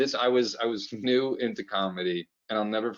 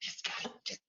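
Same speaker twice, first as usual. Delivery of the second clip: breathy retold whisper from the back of the room; 15 dB quieter than usual. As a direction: whisper it like an audience member judging. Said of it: "I literally heard her whisper".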